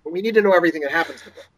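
fast, rushed contradiction. Retold or imitated. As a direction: rattle it off fast. Mocking contradictory query rules.

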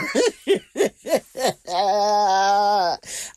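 real laughter; burst of laughter. Real, not acted. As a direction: crack up at the story. Laughing at the story.

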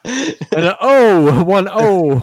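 high, loud, very slow put-on voice; mock drawn-out voice. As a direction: stretch the O out like a joke announcer. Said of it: "the O was great".

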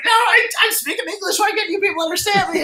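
mock-indignant, high-pitched rant; exasperated outburst. John fed up with the waitress, exasperated high-pitched outburst. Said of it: "John just got, like, beet red".

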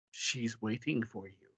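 soft, low murmur; ghostly hush. A narrator's reading. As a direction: say it quietly, like a ghost. Ghost's hushed line in story.

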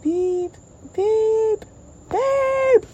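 three high drawn beeps; mock car horn. The same car honking at Stan, three high beeps. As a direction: honk it high three times. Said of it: "it was like".

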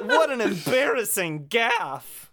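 high, slow, through laughter; giggly, exaggerated embarrassment; laughing mock dismay. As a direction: draw it out in mock horror while laughing. Joking about the game's blunder.

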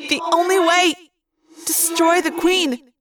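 high, echoing command; urgent inner voice. A narrator's reading. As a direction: drive the order home, drawing out the end. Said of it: "Rachel's voice said".

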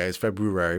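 "February" with roo after Feb; real pronunciation. Shown as a demonstration. Correcting himself on the real saying, "February" as FEB-roo-ary. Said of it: "the real saying of it".

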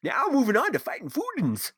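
put-on high, hollering old prospector voice. Continues prospector ad read.

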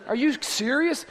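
ordinary talk

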